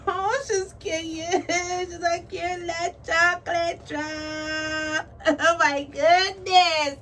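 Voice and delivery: high, drawn-out squeals with laughter; octave-up squealing. Real, not acted. Unwrapping her new baby doll, squealing with delight. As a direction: gush in a rising, giddy squeal. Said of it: "my voice going up an octave".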